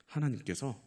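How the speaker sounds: ordinary talk, nothing marked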